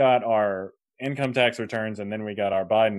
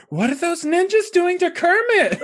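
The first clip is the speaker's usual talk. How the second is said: much higher, louder squeaky mock-wife voice; mock Nicole; 6 dB louder than usual. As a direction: parody the wife's baffled question in a high pitch. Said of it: "Nicole was like".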